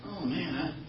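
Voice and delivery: ordinary talk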